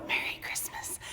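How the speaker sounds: soft festive whisper; hushed, all breath, no voice. Shown as a demonstration. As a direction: whisper it as a little holiday touch. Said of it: "When you whisper Merry Christmas".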